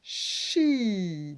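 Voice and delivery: "Chi" with long SH into a held I; blended syllable. Shown as a demonstration. Teacher sounding out a new syllable, drawn-out blend. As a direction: stretch both sounds of "Chi". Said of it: "Donc le ch".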